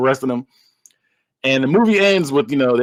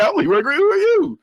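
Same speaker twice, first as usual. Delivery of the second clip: higher, rising mock challenge; retold dad's challenge; 14 semitones higher than usual. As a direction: announce yourself, then question in a high pitch. Retold dad challenging the caller.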